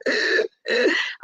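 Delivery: high, strained gasp for air. Retold or imitated. Unable to answer her husband, a strained gasp. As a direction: act out not getting any breath. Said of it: "I'm just like".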